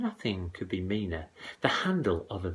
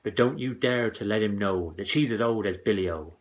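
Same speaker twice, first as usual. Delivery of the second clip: ordinary delivery, nothing marked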